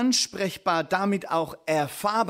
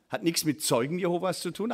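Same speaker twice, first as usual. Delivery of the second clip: ordinary delivery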